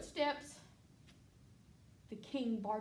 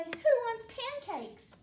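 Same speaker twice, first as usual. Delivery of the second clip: cheery call; 10 semitones higher, 9 dB louder than usual. Retold or imitated. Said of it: "You would also say".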